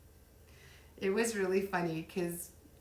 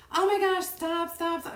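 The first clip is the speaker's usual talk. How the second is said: high, loud frantic stop-stop; hammed-up parent scolding her kids at dinner; mock exasperated yell; 10 semitones higher, 8 dB louder than usual. Mom picturing her own kids at dinner, mock yelling stop. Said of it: "I'd be acting like my children too".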